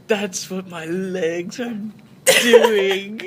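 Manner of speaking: slow wail rising to a loud high cry; hammed-up sobbing, climbing to a howl. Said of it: "he is crying loudly".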